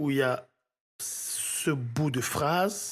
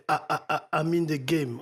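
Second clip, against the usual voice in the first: stuttered repeat. Shown as a demonstration. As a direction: stutter "A" three times before "I'm in the game". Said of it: "J'ai envie de le faire répéter". (bégaiement imité sur « A »)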